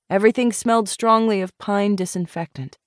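ordinary delivery

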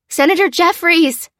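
high shout over the screaming crowd; loud cry. A narrator's reading. Lucy searching the stampede, loud high shout. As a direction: yell his name to be heard. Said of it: "she cried".

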